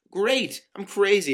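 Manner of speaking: high, loud mock agreement; exasperated sarcasm. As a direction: throw it back loudly in a quarrel. Exasperated retort in acted quarrel.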